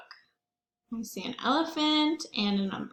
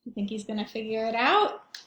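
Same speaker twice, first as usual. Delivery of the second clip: ordinary delivery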